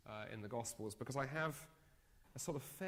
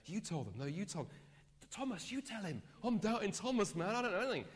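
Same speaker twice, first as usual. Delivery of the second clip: high and louder, passing the buck; nervous nudging disciples; 9 semitones higher, 5 dB louder than usual. Retold or imitated. Disciples nudging each other to answer Jesus, flustered. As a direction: push the job off on a friend.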